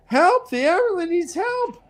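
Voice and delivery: high, frantic call for help; mock cry for help. Heroine crying out for aid over the bodies, high and frantic. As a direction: shriek for help in a hammed-up panic. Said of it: "and she says".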